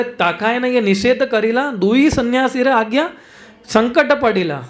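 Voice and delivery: slow, measured verse chant; slow Bengali verse recitation; scripture recitation. Acted. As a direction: recite the verse slowly, reverently.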